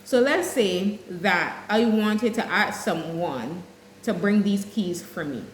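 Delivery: ordinary delivery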